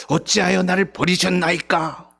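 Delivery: a bitter, reproachful falling question; 어찌하여 나를 버리셨나이까 said resentfully, falling at the end. Shown as a demonstration. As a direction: read it as blaming the father. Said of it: "원망조로 읽어야 할지".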